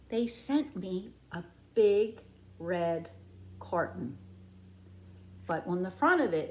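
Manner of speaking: ordinary delivery, nothing marked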